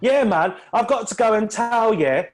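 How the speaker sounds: bad London accent. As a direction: try a London accent and miss it. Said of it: "my best London accent".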